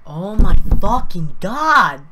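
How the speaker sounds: furious, drawn-out shout at full volume; mad. Real, not acted. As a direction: yell it slowly, at the end of your patience. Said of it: "I'm fucking getting mad".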